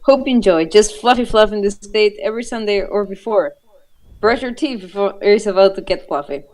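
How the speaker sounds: ordinary delivery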